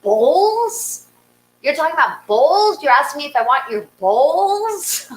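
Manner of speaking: high, rising disbelief; hammed-up astonishment; mock incredulous. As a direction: question it in comic disbelief, nearly laughing. Acted disbelief at neighbor's offer.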